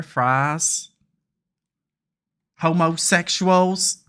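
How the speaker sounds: loud, drawn-out American Southern twang; exaggerated US Southern accent; mock Southern accent. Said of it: "Southern people, like".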